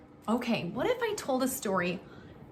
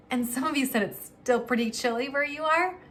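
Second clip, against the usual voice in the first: ordinary delivery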